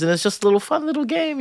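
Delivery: put-on high singsong, as if to a small child; put-on sugary high voice; mock-playful coaxing. Voices the game pitched to a child.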